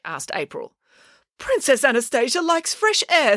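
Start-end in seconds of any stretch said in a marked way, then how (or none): 1.4-3.4 high-pitched prim reply; affronted older woman. A narrator's reading. Woman justifies the cat stroller, affronted. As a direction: answer in a high, huffy old-lady voice.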